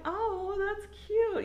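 high squealy coo over something cute; cooing gush. Retold or imitated. Friend retelling how she cooed at a cute restroom, high squeal. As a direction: squeal it like you just saw something adorable.

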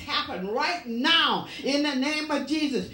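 driving, insistent pulpit delivery; fervent preaching. Real, not acted. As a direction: preach it hard, pressing on without letting up. Preaches at full force.

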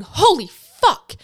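loud, high, slow re-enacted shock; shocked outburst. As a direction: stretch out each word in loud disbelief. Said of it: "So I gasped".

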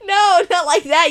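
laughing protest. Real, not acted. Laughing denial.

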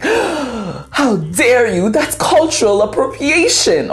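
milk the shock like a soap opera; dramatic mock outrage. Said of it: "she didn't say it like that. I'm just being dramatic".

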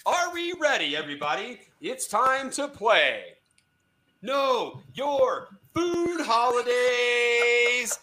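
high, slow, drawn-out announcement; game show host. Acted. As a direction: announce the title big.

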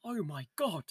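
a quiet, hushed "Oh my God" in a put-on British accent; hammed-up British Columbia accent; mock British accent. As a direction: gasp it like a shocked British child. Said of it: "that was a good accent".

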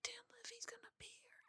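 hushed, voiceless awe; starstruck whisper. Retold or imitated. Fan in the producer's car, starstruck whisper. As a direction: whisper it as if trying not to freak out.

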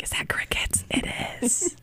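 hushed whisper so the mic misses it; whisper, trying not to be heard. Real, not acted. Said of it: "We were being quiet".

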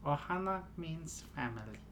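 soft cartoon alien voice; Stitch impression. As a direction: say it tenderly like Stitch. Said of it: "our latest special guest, Stitch".

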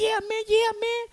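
very high-pitched mock young man; imitated eager agreement. Preacher imitates young brother agreeing, high and eager.

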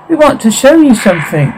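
put-on high, eerie childlike lure; child's voice. Ghost child luring the family outside, high and eerie. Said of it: "Children's voice".

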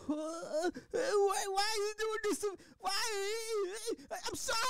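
high-pitched wailing imitation of a victim; mock crying plea. Acting out a crying, pleading victim.

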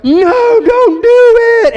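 put-on high, loud, drawn-out kids' shout of alarm; kids yelling no. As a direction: yell it like panicked schoolkids stopping the teacher. Kids panicking at teacher's marker.

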